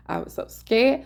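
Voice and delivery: usual speaking voice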